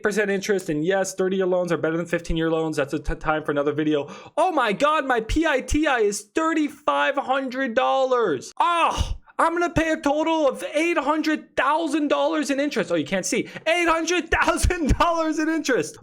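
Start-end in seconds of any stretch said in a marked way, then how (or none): 4.4-12.9 theatrical disbelief at the cost; mock shock. Sees the huge interest total. 13.7-16.0 higher, emphatic horrified repeat; stunned, stressing eight; mock outrage. Repeats the shocking total.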